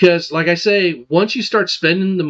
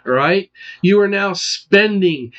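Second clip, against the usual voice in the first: usual speaking voice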